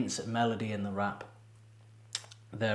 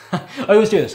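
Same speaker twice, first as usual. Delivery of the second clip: laughing; 10 semitones higher, 15 dB louder than usual. Real, not acted. Laughs at own habit.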